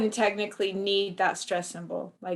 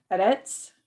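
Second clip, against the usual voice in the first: "edits" stressed on its final syllable, as a question; tentative ed-ITS with end stress; stress on last syllable. Shown as a demonstration. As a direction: push the second syllable of "edits". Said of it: "if it didn't have that stress".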